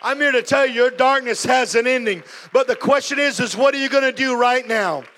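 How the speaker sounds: loud, high preaching to the room; preaching at full force. Acted. Preacher urging the congregation to act now, loud and high. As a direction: drive it home from the pulpit. Preacher builds to altar call.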